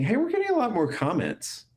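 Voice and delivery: high, delighted realization; pleasant surprise. Retold or imitated. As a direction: notice it with sudden delight. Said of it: "I just started noticing".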